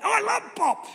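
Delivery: put-on high child voice answering his grandfather; grandchild voice. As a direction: squeak it like a little boy. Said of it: "do you love Pop?".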